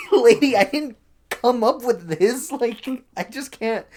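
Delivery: high, loud, drawn-out mock protest; laughing exasperation, words stretched out; exasperated. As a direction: let the disbelief push the pitch way up. Acted exasperated thought about customer.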